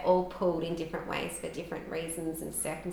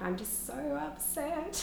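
higher, softer, slow mock wail; tearful whine; 9 semitones higher, 6 dB quieter, slower than usual. To her sister on the phone, a higher, softer teary moan. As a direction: drag it out like a half-laughing sob. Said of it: "said to her, I was like".

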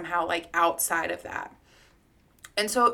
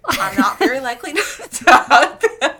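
laughing through the words, louder and higher; 4 semitones higher, 11 dB louder than usual. Real, not acted. Admitting she rarely hugs strangers, laughing. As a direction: break into real laughter mid-sentence. Laughs at own hug confession.

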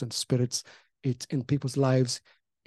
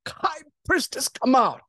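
commanding call; 9 semitones higher, 4 dB louder, slower than usual. Retold or imitated. Commanding a demon out.

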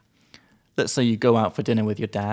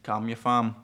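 put-on Aussie teen voice; slower than usual. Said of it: "we would still say".

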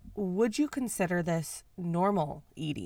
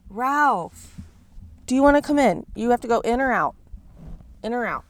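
higher, louder coaxing call to a pet; calling a cat; 5 semitones higher, 9 dB louder than usual. Real, not acted.

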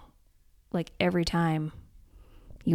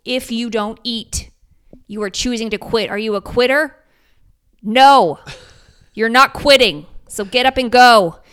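firm coaching yell; 5 semitones higher, 12 dB louder than usual. Retold or imitated. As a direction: push him on like a tough coach yelling at a runner. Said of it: "terrible yelling at you".

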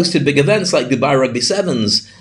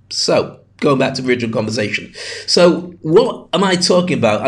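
ordinary delivery

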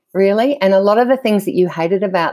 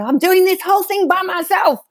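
retold yell; 12 semitones higher than usual. To her husband in the canoe, a high retold yell. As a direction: throw it out shrill and exasperated without much volume. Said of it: "I yelled at him".

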